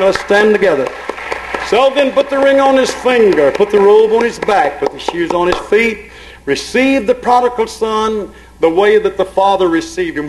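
exhorting with punched, lifted phrases; fervent preaching. Real, not acted. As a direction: preach it forcefully to the congregation.

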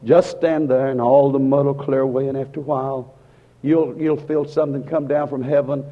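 ordinary delivery

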